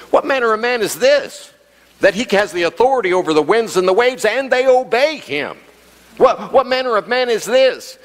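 high, astonished question. Acted. Shaken disciples asking each other about Jesus, high and astonished. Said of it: "another translation says".

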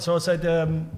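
usual speaking voice

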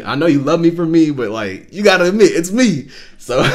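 high, loud mock pleading with God; playful protest. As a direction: argue your case, half joking, voice climbing. Jokingly pleading his case to God.

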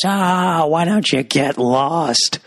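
high, drawn-out dismissive jeer; slow, mocking old-man whine; old-time comedy crank. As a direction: brush them off like a hammy old-time comedy grouch. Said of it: "Like I'm in some Little Rascals episode".

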